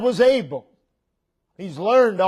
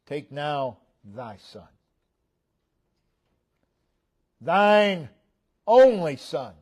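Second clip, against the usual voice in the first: "Thine" and "only" set apart by pauses, drawn out; slow reading, lifting "Thine" and "only" after "thy son"; emphatic reading; 3 semitones lower, slower than usual. Shown as a demonstration. Preacher showing the congregation the emphasis in God's command, slow and emphatic. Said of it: "But the emphasis".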